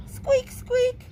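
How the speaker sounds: high, squeaky mouse sounds; nervous mouse. Acted. Mouse answers the kitten, nervous high squeak. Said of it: "said the mouse nervously".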